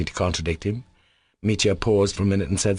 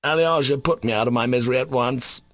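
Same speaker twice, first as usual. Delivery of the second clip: exclaimed suddenly; 5 semitones higher than usual. Acted. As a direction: burst out with his name. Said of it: "he exclaimed suddenly".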